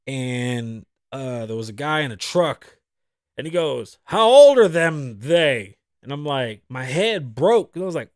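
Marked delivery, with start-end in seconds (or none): 4.0-5.8 drawn-out holler, imitating a stranger; loud quoted question. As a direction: imitate a man calling from his truck. Quoting a stranger in a truck.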